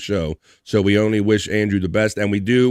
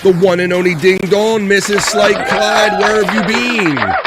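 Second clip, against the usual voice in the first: higher, louder, slow announcer build-up; hype announcement; 9 semitones higher, 5 dB louder than usual. Real, not acted. Host welcomes back a long-absent member, slow higher announcer hype. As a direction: roll the name out like a ring announcer.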